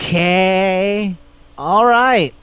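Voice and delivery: hesitant agreement, hammed up; reluctant acceptance. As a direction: act out going along with it, stretching the words. Acted reluctant acceptance of odd arrangement.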